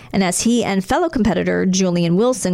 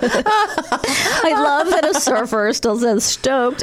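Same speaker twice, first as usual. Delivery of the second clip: laughing; 4 semitones higher, much slower than usual. Real, not acted. Laughing over the surfer quote.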